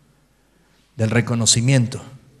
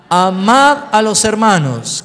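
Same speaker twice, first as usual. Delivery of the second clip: dicho fuerte y lento; lectura fuerte; 6 semitones higher, 8 dB louder, slower than usual. Shown as a demonstration. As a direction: proclama el mandato. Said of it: "¿Qué más?". (lee con la iglesia fuerte y pausado)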